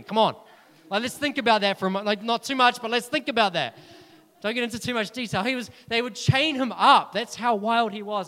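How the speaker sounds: loud, fast preaching at full force; fired-up preaching. Real, not acted.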